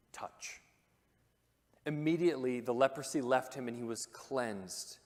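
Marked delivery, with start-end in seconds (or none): 0.1-0.6 a hushed single whispered word. Real, not acted. Preacher whispers the moment Jesus touches the leper. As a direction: breathe the word out for awe. Climax of the healing.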